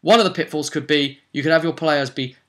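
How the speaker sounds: usual speaking voice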